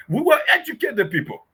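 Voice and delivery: normal talking voice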